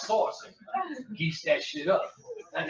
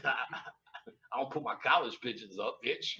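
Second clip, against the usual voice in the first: usual speaking voice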